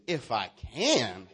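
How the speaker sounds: slow, drawn-out sass; with attitude. Shown as a demonstration. Preacher shows congregation how to say it, with attitude. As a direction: stretch it out with a swooping attitude. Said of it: "Now say it with attitude".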